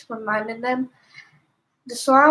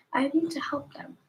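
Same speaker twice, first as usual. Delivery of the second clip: breathy whispered resolve; whispered thought; 3 semitones higher, 6 dB quieter than usual. A narrator's reading. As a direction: whisper it to yourself. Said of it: "Sorry about that".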